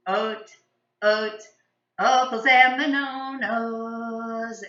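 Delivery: "O look to oat" sung with every vowel turned to long O; slow sung verse swapping every vowel for O; all vowels as O. Shown as a demonstration. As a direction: sing "bananas" as "bonoknows". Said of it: "O sound".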